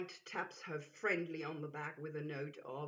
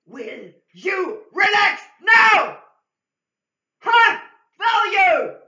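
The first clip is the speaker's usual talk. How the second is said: much higher, much louder slow drill-sergeant shout; military bark; 12 semitones higher, 23 dB louder, slower than usual. Acted. Military figure orders the lady to relax, shouting. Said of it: "with a quiet military voice".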